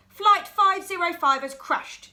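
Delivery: boy playing pilot, urgent and excited; excited alarm. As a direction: raise the alarm. Luther's excited crash alarm.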